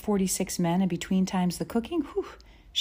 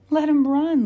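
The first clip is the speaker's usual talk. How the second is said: high, full-out exultant shout; 11 semitones higher, 11 dB louder than usual. A narrator's reading. Lena urging on the runaway ponies, shouting. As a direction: shout it out over the racing horses. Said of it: "Lena shouted".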